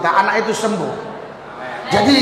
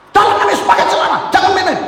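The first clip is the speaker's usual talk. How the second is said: louder, higher, fast rebuke in Jesus' name; stern command; 10 semitones higher, 3 dB louder, faster than usual. Retold or imitated. Preacher rebukes the possessed son, loud and stern. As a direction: order him sharply to put on pants.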